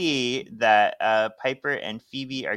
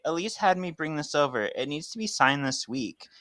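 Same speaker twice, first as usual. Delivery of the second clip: higher, fast, rambling explanation; lightly voiced chatty Robin; 6 semitones higher, faster than usual. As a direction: prattle on in a lifted voice. Plays Robin in the recap.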